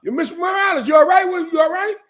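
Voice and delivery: put-on high, shouted call from the block kids; young guys shouting to check on her; retold calling out. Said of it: "It said".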